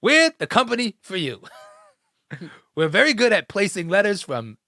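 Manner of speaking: high-pitched, hammed-up commercial pitch; playful salesman spiel, lifted and punchy; mock ad pitchman. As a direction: sell it like a cheesy company advert.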